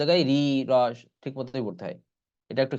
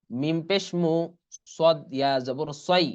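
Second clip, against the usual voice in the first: ordinary talk, nothing marked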